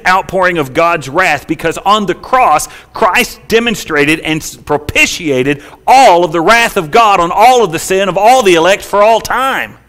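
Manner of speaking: loud, high, emphatic sermon delivery; full-force preaching. Real, not acted. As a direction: drive it home to the whole congregation.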